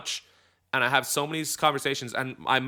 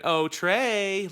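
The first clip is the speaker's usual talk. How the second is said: higher, teasing sing-song on Oh, Trey; mocky intonation; 8 semitones higher than usual. Shown as a demonstration. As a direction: sing Oh, Trey up high like mocking a kid. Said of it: "the intonation originally was more like an".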